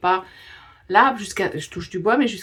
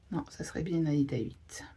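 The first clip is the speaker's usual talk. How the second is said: whispering; 3 semitones lower, 9 dB quieter than usual. Real, not acted. Checking her notes, muttering to herself.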